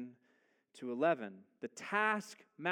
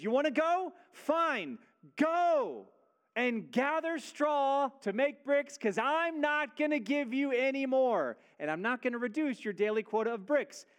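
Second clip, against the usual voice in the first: much higher and louder sneering Pharaoh, mocking the people; arrogant mockery; 9 semitones higher, 6 dB louder than usual. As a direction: taunt them, "you wanna go? go do that". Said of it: "An arrogant mockery".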